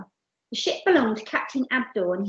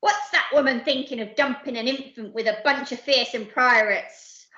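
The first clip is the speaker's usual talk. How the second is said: loud, raised outrage settling into grumble; howling pirate captain; 4 semitones higher, 4 dB louder than usual. Acted. Captain stuck with a baby, howling pirate rant. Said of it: "howled Captain Abdul".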